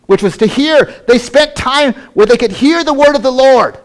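high-pitched, emphatic sermon delivery; impassioned preaching. Real, not acted. Preacher telling the congregation of heroes of faith, impassioned. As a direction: preach it with rising fervour, pitch lifted.

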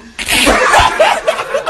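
high shrieking laugh; loud burst of laughter. Real, not acted. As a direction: cackle out loud, real.

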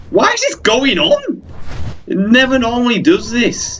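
high-pitched exasperated outburst; infuriated. Real, not acted. Gamer baffled by the bird, infuriated. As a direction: wail it in real frustration. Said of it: "I'm actually getting infuriated by this".

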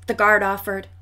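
plain reading voice

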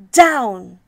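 loud sharp cry of Down; shouting. A narrator's reading. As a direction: yell it out to land. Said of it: "cried the witch".

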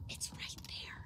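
hushed, breathy whisper. Real, not acted. Streamer whispering to chat about a find. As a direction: whisper it so nobody hears. Spotting something in game.

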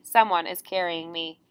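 ordinary delivery

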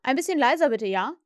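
insistent request to quieten down; calling out. A narrator's reading. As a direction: call over the noisy children. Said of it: "rief Peter".

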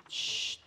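a long unvoiced shh to quiet the room; teacher shushing noisy students. Real, not acted. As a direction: hush the class with a steady shh.

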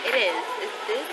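ordinary talk, nothing marked

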